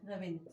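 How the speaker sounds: रविंद्र clipped low, nasal short रविं; wrong pronunciation. Shown as a demonstration. Teacher imitating how people wrongly say रविंद्र, low and clipped. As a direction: mumble रविंद्र the common wrong way. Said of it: "हम उसको इस तरह बोलते हैं".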